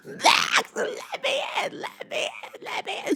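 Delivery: high, shrill panicked cry fading to a whisper; frantic screaming plea. Retold or imitated. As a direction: beg to be let in, terrified. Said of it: "She was, like, screaming".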